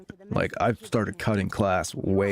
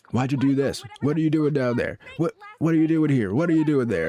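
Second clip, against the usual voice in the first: nagging parent; 7 semitones higher than usual. Retold or imitated. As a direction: keep firing the same question. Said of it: "always, like, a".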